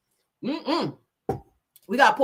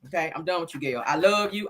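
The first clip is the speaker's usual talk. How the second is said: usual speaking voice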